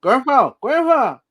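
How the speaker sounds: put-on high, loud baby call; toddler calling. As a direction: shout it like a little one with a pacifier. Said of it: "with the binky in his mouth".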